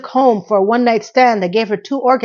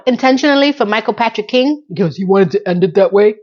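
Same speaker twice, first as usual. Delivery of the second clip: ordinary talk, nothing marked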